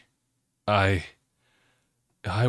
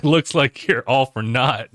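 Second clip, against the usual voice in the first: loud, higher smug taunt; cocky taunt; 4 semitones higher, 6 dB louder than usual. Retold or imitated. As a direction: sneer it out loud. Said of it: "It's like".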